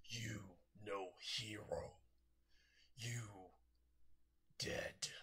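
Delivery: slow, soft, hollow creature voice; broken monster speech. Acted. Monster taunts All Might before vanishing, hollow and soft. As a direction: speak like a beast with few words. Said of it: "looks at All Might and says".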